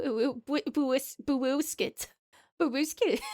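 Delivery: attempted British mum with babyish w-for-r sounds; British uwu baby-talk mum. Streamer doing requested redeems, British uwu baby-talk voice. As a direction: mix a British accent with cutesy baby talk. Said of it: "mum voice, a British accent, and an uwu baby".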